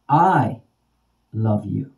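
single out "I", then let it fall; stress on I. Shown as a demonstration. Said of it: "Same words, different intonations".

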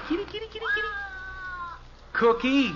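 very high sing-song kitty call; coaxing a cat. Acted. Dad in the rafters calling the cat, very high sing-song.

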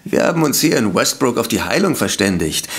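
normal talking voice

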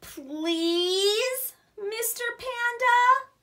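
loud pleading Please drawn out; big emphatic please. Acted. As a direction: make Please the biggest word of all. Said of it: "Look at the biggest word".

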